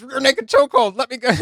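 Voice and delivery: M's chokehold line. Retold or imitated. Retelling the fight, M yells in a high hammed-up voice. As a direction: shout it like you're grabbing him. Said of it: "And M goes".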